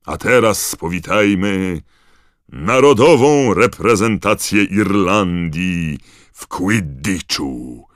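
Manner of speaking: loud, slow, grand introduction; roaring announcer. A narrator's reading. Bagman welcoming the Irish team, roaring. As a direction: roar it out, stretching each big word. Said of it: "ryknął Bagman".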